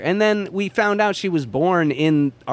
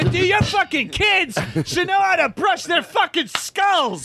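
high, slow mock shouting; mock outrage about kids brushing teeth; mock rant; 12 semitones higher than usual. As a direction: rant in a hammed-up high yell. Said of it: "I'm getting political over here".

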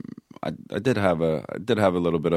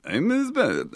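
mock Uzbek accent; 12 semitones higher, slower than usual. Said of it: "I can do any accent".

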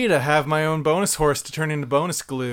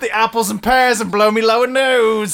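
cheery Cockney showman, louder; Cockney accent; 4 semitones higher, 4 dB louder than usual. Acted. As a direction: ham up a London Cockney geezer. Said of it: "Cockney rhyming slang".

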